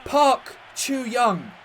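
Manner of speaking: ecstatic roaring celebration; excited shout. Real, not acted. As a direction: yell it like a last-minute winner. Late winning goal celebration.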